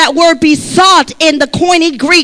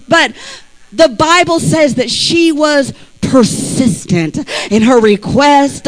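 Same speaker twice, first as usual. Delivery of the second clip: ordinary delivery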